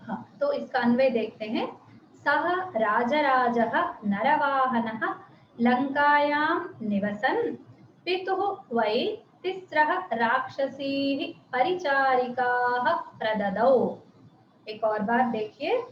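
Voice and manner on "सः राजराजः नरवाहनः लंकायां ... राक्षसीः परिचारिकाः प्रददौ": slow, each word set apart with a rise; slow word-by-word reading. Shown as a demonstration. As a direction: read the prose order slowly, one word at a time. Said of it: "मैं अलग-अलग शब्दों जैसा पढ़ रही हूँ". (अन्वय को धीरे-धीरे शब्द-शब्द अलग पढ़ना)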